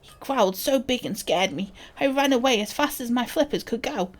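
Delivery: put-on lively, childlike storytelling voice; animated kid character reading aloud; child reading a note. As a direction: tell it like an excited little creature.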